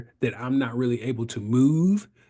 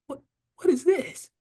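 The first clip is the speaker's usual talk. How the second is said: much higher, baffled question; bewildered; 13 semitones higher than usual. Retold or imitated. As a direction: play the confused patient grabbing the notepad. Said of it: "I said".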